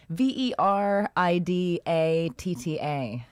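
slow, letter by letter; each letter said separately at a slow pace; spelling it out. Shown as a demonstration. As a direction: spell the brand name out deliberately. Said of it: "How do you spell that?".

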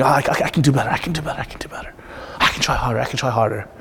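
low repeated self-urging, fading into a whisper; muttered self-talk. Acted. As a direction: mutter it to yourself, nearly voiceless. Acts out his own self-talk.

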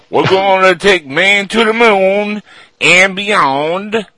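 put-on high, loud, slow Boston Kennedy accent; bad Kennedy impression. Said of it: "That's a horrible accent".

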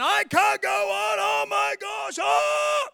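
high, drawn-out mock-panicked scream; screaming and yelling. Preacher mimics people who can't go on, screaming and yelling. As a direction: yell it out long and hammed-up. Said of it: "start screaming and yelling".

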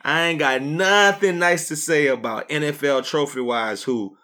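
ordinary talk, nothing marked

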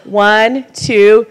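usual speaking voice

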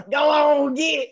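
high, drawn-out Southern holler; Southern accent. Shown as a demonstration. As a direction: laughingly shoo cats in a Southern accent. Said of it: "We say that to our cats".

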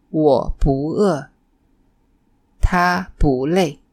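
不 said rising as a second tone before 饿 and 累, slow and clear; teacher demonstrates tone change of 不 for learners, slow. Shown as a demonstration. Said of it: "不 changes to a second tone".

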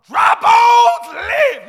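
mock prophecy. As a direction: drag out each word like a grand prophecy. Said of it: "I'm gonna prophesy to my husband".

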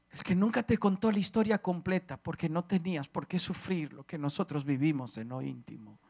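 ordinary talk

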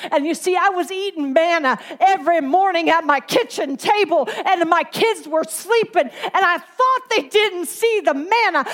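loud, high, driving preacher cadence; full-force preaching. Acted. Preacher urging the church to collect for their household, full force. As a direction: preach it out to the congregation at full power.

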